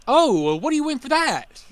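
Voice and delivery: mock entitled customer. Customer asking the game worker for a prize, mock entitled. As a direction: play the customer who thinks he won.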